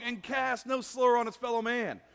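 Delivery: high, loud, slow declamation of scripture; loud preached psalm reading; preaching at full force. Acted. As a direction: proclaim the psalm to the whole room.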